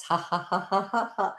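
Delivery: even, deliberate ha-ha; rhythmic exercise laugh; laughter. Shown as a demonstration. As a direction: laugh along with a pretend chore. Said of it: "with a sense of fun and laughter".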